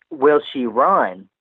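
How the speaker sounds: usual speaking voice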